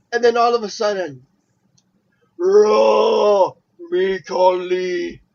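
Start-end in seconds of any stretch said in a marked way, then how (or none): 2.4-3.5 big booming shout; Khali shout. Retold or imitated. 3.9-5.2 slow, heavy broken English; lumbering giant voice; Khali voice. Retold or imitated. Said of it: "Khali impression".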